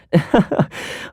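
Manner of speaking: short easy laugh; light chuckle. Acted. Hearing of an acting grandmaster, light chuckle. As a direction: give a brief chuckle.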